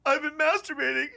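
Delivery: very high, rising squeaky wail breaking into a laugh; pious roommate confessing. Retold or imitated. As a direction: whimper it out like a guilty choirboy. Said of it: "He goes".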